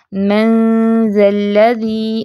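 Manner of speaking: मंजल्लज़ी slow, with a thin light nasal ghunna; light ghunna ikhfa. Shown as a demonstration. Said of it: "इख्फा मोटा नहीं करेंगे". (मंजल्लज़ी बारीक गुन्ने के साथ)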